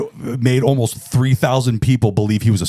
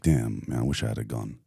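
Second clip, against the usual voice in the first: mock disappointment; 11 semitones lower, 10 dB quieter than usual. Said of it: "the guy looked disappointed".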